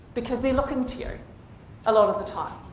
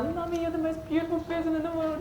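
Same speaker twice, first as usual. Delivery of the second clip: put-on doting child; 5 semitones higher, 5 dB quieter, faster than usual. Child's loving words quoted.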